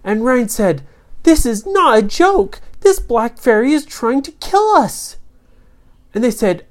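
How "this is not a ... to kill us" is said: high, loud alarmed hero; storybook hero in alarm; alarmed warning. Acted. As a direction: warn your friends urgently, pitched up high.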